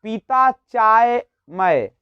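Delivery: slow, deliberate jumbled order; उल्टा क्रम, धीरे-धीरे. Shown as a demonstration. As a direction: drag out the wrong word order. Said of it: "ऐसे मत करिए". ("पीता चाय मैं" धीमे से)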